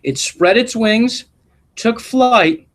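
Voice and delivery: gentle storybook read-aloud voice; expressive classroom story reading; reading to children. A narrator's reading. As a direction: read it like a picture book to a class. Reads picture book aloud.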